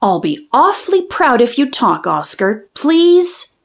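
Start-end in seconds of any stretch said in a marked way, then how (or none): none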